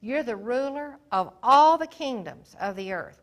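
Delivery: loud, fervent cry to God; king praying aloud before his people, loud and fervent; impassioned prayer. Acted. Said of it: "And he said".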